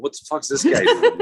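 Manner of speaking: high, loud, laughing inner voice; high laughing what-is-he-doing; laughing disbelief. Retold or imitated. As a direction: crack up at the strange guy. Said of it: "I'm thinking".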